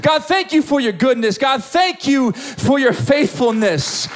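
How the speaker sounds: high, impassioned prayer, slowing at the end; fervent praise. Acted. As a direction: pour out thanks to God. Acts out fervent prayers of request, praise.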